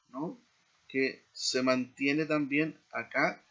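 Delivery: ordinary talk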